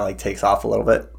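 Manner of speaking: ordinary talk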